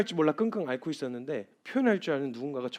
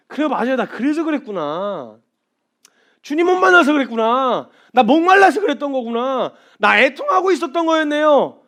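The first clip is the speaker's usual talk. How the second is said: high, loud, overwhelmed realisation; loud fervent confession; 10 semitones higher, 13 dB louder than usual. Retold or imitated. Believer answering the Lord, loud relieved confession. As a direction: cry it out in relief. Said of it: "표현해 주시는 이게".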